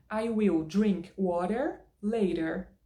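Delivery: American accent, WATER and LATER both with tapped T and final R; slow demo in an American accent; American English, matching R on WATER and LATER; consistent American accent. Shown as a demonstration. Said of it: "Se eu falo water, eu tenho que falar later".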